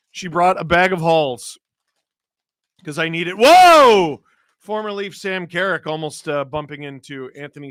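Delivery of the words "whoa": loud high startled whoa; surprised whoa. Real, not acted. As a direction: yelp at the near collision. Near collision on ice.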